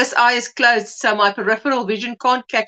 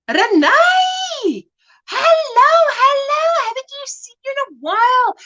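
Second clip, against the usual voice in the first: excited greeting; 13 semitones higher, 3 dB louder than usual. Real, not acted. Excited greeting of old friend.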